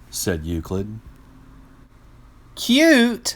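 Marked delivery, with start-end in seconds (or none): 2.6-3.4 very high, loud squeal of delight; cheering. Acted. Friends cheer, mishearing acute as cute. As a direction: squeal it like it's adorable. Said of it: "cheered Euclid's friends".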